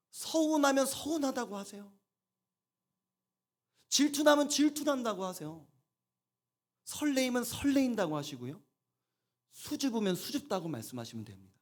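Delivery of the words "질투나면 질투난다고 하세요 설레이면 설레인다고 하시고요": ordinary talk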